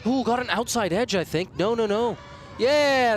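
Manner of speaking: high, loud appeal call; rising thrill at the wicket; excited. Real, not acted. Wicket appeal, commentator erupts.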